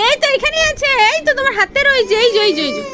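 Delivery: urging her pet to grab the puffed rice, high and coaxing; coaxing pet talk. Acted. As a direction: cheer the little one on like a baby.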